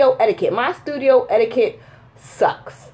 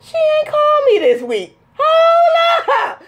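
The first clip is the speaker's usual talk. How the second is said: high, indignant realization building to a shout; mock alarm; 13 semitones higher, 3 dB louder, slower than usual. Daughter noticing mom hasn't called, mock alarm. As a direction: voice shoots up, hammed-up outrage. Acted sudden alarm at missed call.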